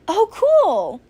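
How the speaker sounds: high, loud and excited; light up at the news. Acted. Excited reply in chat.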